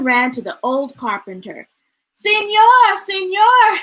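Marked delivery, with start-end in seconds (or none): 2.2-3.8 wheezing. A narrator's reading. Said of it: "he wheezed".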